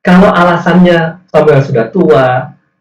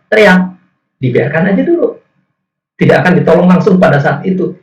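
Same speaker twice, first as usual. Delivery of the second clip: ordinary delivery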